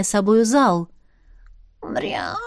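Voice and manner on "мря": soft low cat mew; a dreamy little cat sound; dreamy meow. A narrator's reading. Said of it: "мечтательно мявкнул Кимрик". (мечтательное мяуканье)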